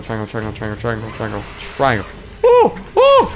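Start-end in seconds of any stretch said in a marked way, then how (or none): 2.5-3.3 very high, loud surprised ooh; surprised squeal. Real, not acted. Gamer startled by the baby, squealing high. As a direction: yelp of surprise at the screen. Reacts to game event.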